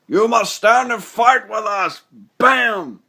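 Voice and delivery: rally the troops mid-charge; battle cry. Retold or imitated. Optimus battle cry from trailer.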